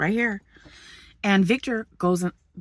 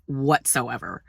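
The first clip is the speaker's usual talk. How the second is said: ordinary delivery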